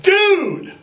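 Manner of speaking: high, pleading stoner voice; whiny high dude voice; stoner plea. Retold or imitated. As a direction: beg him like a panicked stoner. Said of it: "I'm like".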